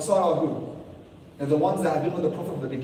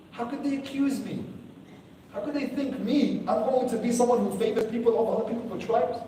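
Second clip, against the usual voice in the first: hurt disbelief; 7 semitones higher than usual. Retold or imitated. The hurt Prophet's imagined thoughts.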